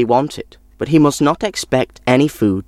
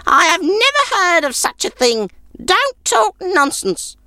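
put-on scoffing old woman. A narrator's reading. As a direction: dismiss it as nonsense.

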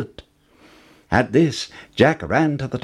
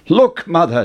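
raised, excited call to his mother; excited cry; 6 semitones higher, 4 dB louder than usual. A narrator's reading. Jack calls to his mother, lightly excited. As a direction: Jack shows off his prize, a touch eager. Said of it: "Jack cried".

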